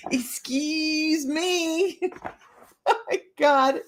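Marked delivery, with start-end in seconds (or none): 0.1-2.0 very slow, prissy excuse me; drawn-out mock huff. Teasing the viewer back, slow mock offence. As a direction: drag each word out, teasing.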